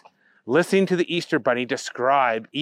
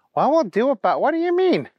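high-pitched bewildered question; baffled Booger; 11 semitones higher than usual. A narrator's reading. Booger questioning the bunny's Easter plan, baffled and high. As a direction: climb high in confusion.

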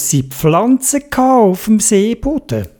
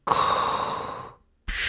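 snorkel hiss; 12 dB quieter than usual. A narrator's reading. Snorkel breath sound.

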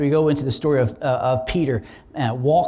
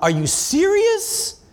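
higher, slow, drawn-out disbelief; exasperated; 13 semitones higher, slower than usual. Retold or imitated. Pastor complaining to God in Walmart, exasperated disbelief. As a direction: stretch it out, fed up with God.

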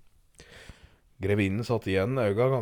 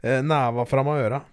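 ordinary talk

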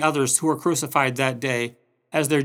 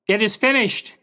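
high, raised final cry; Jesus calling out; 7 semitones higher, 4 dB louder than usual. Retold or imitated. Jesus calling out before death, loud cry. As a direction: call it out strongly, pitch well up. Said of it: "John heard Jesus call out".

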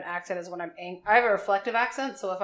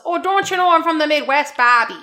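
high, loud Midwestern mom caricature; Bobby's mom; 8 semitones higher, 10 dB louder than usual. Acted. Cartoon mom calling to her son, hammed-up Midwestern. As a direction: belt it out in a broad Midwestern accent. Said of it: "But Bobby's mom was".

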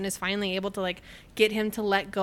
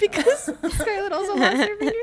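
high, louder words through laughter; laughing explanation; 11 semitones higher, 5 dB louder than usual. Real, not acted. Explaining the no fingers club, laughing. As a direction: crack up while explaining.

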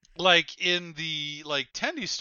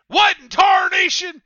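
high, very loud exasperated holler; full-voiced mock outburst, pitched way up; imitated yell; 14 dB louder than usual. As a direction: yell it out like a Southerner remembered from childhood. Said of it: "well, hearing yelled".

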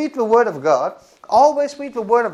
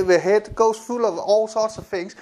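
ordinary talk

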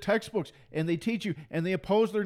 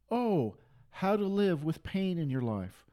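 ordinary talk

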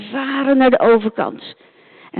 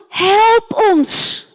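pleading call; 10 semitones higher than usual. Acted. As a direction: shout it across the water, begging. Man calls across the sea. (hoog en luid om hulp roepen)

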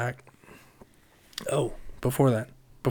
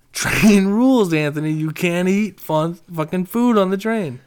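raised scolding, slowed and emphatic; 6 semitones higher, 6 dB louder than usual. Retold or imitated. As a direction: lay down the train rules firmly. Scolding drunk friend on train.

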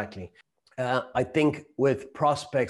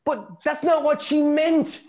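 much higher and louder, slowing to a mock shout; exasperated wife; 14 semitones higher, 4 dB louder than usual. Wife exasperated with clueless husband, much higher, slow mock shout. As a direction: insist as if he's hopeless.

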